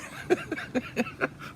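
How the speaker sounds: high, wheezy chuckle; amused laugh. Real, not acted. Storyteller tickled by his star-struck moment, amused laugh. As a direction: laugh at your own story.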